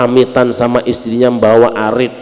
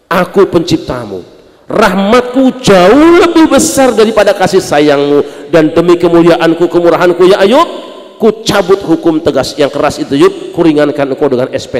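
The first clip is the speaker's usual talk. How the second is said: voice of God; 7 semitones higher, 8 dB louder than usual. Acted. God speaking to Ayub.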